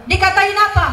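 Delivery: high-pitched sharp question; imitated demanding mother. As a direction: demand an answer as the impatient mother. Mother questions crying child.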